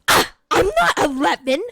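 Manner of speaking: loud mock-indignant outburst; indignant protest. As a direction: imitate an offended girlfriend, raising your voice. Acts out her offended outburst.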